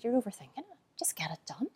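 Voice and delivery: whispered advice. Retold or imitated. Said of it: "he said".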